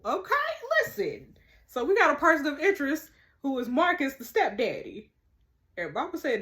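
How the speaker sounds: ordinary delivery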